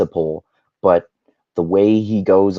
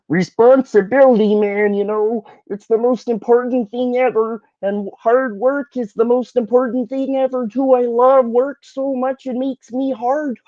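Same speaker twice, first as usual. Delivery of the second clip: high mocking falsetto parody; much higher, exaggerated mocking lecturer; Jordan Peterson voice. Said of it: "when he starts talking about".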